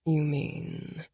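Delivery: soft, low, teasing man's murmur; low sexy murmur. A narrator's reading. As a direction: purr it low and slow. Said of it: "he murmured in a low, sexy voice".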